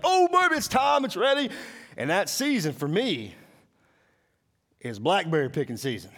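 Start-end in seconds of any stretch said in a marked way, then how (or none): 0.0-1.5 high, loud giddy cry; excited. Retold or imitated. Preacher reliving blackberry season joy, high and loud. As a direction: squeal like it's finally here. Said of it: "I'm like".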